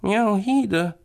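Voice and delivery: very high kitty voice; shy purring cat. A narrator's reading. Tomcat shyly agrees with the boy, high cat voice. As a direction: mew it timidly like a cat. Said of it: "schnurrt Bonifaz schüchtern".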